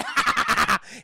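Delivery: imitated cackle, a rapid loud ha-ha-ha; mock gremlin cackle. Streamer imitates a gremlin exposing himself, mock cackle.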